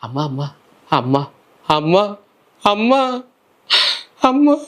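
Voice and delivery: calling mother, rising to a desperate cry. Retold or imitated. (మెల్లగా మొదలై గట్టిగా పెరిగే పిలుపు)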